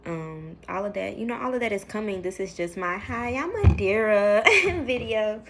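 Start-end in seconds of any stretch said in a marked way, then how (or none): 3.0-5.4 laughing. Real, not acted. Laughing at own video intro.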